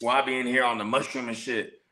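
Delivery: ordinary talk, nothing marked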